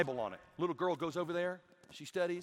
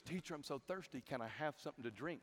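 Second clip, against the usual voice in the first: put-on very soft, timid child request; quiet little mock-child plea; imitated little boy; 3 semitones lower, 13 dB quieter than usual. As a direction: play a small boy asking teacher, hushed. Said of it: "he looks at this teacher and goes".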